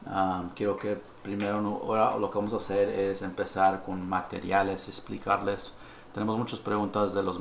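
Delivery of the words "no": ordinary talk, nothing marked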